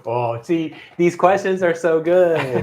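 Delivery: laughing while talking; giggly, delighted laugh through words. Real, not acted. Delighted by the audience questions.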